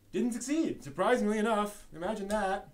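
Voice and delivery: high, loud mock surprise; sarcastic. Real, not acted. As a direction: act amazed that it flopped, dripping sarcasm. Mocking a failed toy line.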